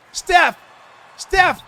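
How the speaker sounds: high, loud call of the play; real courtside yell; excited shout. Real, not acted. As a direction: yell the names as it happens. Calling out the missed shot.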